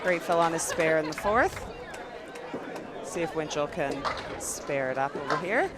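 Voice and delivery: bowling call, lively then hushed; live play-by-play. Acted. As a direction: call each ball as it rolls.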